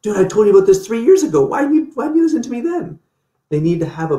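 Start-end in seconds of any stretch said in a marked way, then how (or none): 0.0-3.0 high, loud, fast mock scolding; exasperated friend. Friend mocks the late convert with exasperated high scolding. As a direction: squeak out an I-told-you-so.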